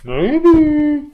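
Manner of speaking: high, loud, drawn-out "maybe"; mysterious caller. Retold or imitated. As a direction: play the coy mystery caller. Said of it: "He went".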